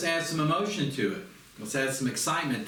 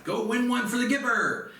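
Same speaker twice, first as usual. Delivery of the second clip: Knute Rockne pep talk; 8 semitones higher than usual. Retold or imitated. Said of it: "I'm Knute Rockne and I'm like".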